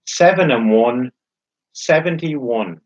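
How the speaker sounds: slow, drawn-out bingo calling; bingo caller. Acted. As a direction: call each number slowly, then repeat it.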